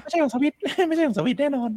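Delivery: high-pitched laughing denial. Real, not acted. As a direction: laugh through the words. Laughing denial of friend's claim.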